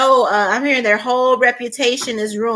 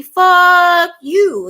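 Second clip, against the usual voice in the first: sudden loud, high snap of contempt; defiant curse; 5 semitones higher, 6 dB louder than usual. Retold or imitated. Politician cursing out the man with evidence, loud and defiant. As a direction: spit it out at the investigator, much louder and higher.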